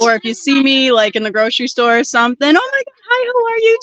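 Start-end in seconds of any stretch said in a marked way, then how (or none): 2.5-3.8 high gushing fan greeting; excited fan voice. Retold or imitated. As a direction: gush like an eager fan. Mimics fan greeting in store.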